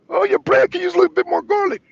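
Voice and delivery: high, quick, fussy customer voice; mock picky diner. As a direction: chirp the complaint up high and fast. Customer critiques bread.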